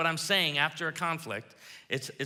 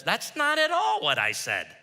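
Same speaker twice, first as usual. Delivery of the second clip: 1 annoyed objection; 7 semitones higher, 7 dB louder than usual. Retold or imitated. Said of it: "your spouse says".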